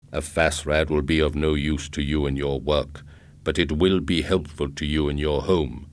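cold, even robot pitch; robot salesman. A narrator's reading.

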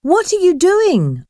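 sudden high startled call; alarmed cry at the girl on the rail; surprised. A narrator's reading. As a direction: catch her off guard, voice jumping up. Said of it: "asked Lucy, surprised".

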